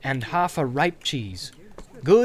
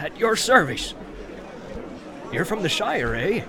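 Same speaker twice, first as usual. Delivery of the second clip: bustling innkeeper; 3 semitones higher than usual. Acted. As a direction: greet the guest heartily. Landlord introduces himself.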